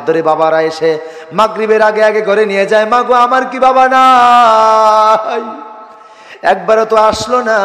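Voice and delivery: loud, long melodic cry; plaintive preaching. Acted. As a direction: preach an orphan child's plea to his mother.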